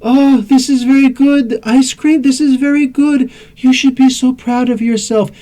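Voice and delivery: put-on high, slow, foreign-accented monotone; mock her stiff accented joke delivery; monotone accented Wonder Woman. Wonder Woman praising ice cream, stiff accented monotone. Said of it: "even in her jokes".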